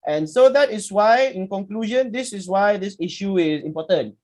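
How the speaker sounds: high, pressing-on wind-up; mock presenter's conclusion. Imitating a student concluding in front of class, high-pitched speechifying. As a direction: act a presenter labouring toward the ending.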